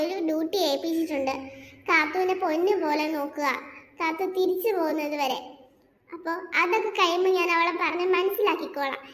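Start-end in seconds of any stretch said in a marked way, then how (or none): none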